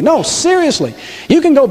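pitched well up, insisting; earnest insistence. Real, not acted. Preacher answers a doubting listener, earnest insistence. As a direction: jump up high to insist you mean it. Insists against imagined heckler's disbelief.

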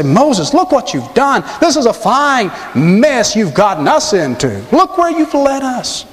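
hammed-up griping, high and drawn out; mock carping and criticizing; griping complaint. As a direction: scold him slowly, pitch swooping high. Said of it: "to carp and to gripe and to complain".

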